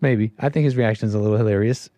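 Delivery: usual speaking voice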